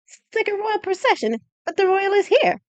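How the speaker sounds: put-on bright, high young woman's voice; high excited girl voice. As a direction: chirp it out, high and eager. Daisy gossiping about procession.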